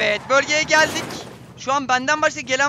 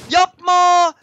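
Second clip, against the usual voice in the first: loud, high cry of no; real frantic plea; panicked shout; 8 semitones higher, 12 dB louder than usual. Real, not acted. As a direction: yell it in desperate alarm. Begging the buggy not to.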